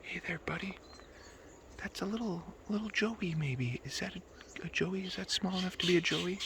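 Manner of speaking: soft hushed voice near a kangaroo; wildlife watching whisper. Real, not acted. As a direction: keep it hushed and gentle. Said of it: "Trying to be quiet so we don't scare him".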